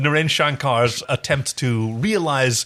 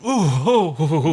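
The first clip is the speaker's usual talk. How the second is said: usual speaking voice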